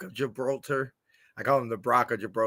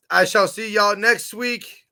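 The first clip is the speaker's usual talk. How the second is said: ordinary talk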